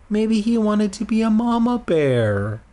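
high, put-on sweet voice; cutesy cooing. Reader jokes about teddy sitting on eggs, cooing. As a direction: coo it high like baby talk. Coos a cute joke.

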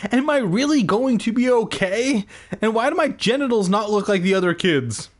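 put-on high, anxious kid questioning; insistent. A narrator's reading. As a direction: push the questions out high, speeding up. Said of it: "I insisted".

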